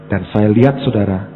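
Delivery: sudden loud burst on lihat; emphatic shout. Real, not acted. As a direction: hit the words hard to grab the congregation. Pengkhotbah menekankan. (seruan keras penuh penekanan)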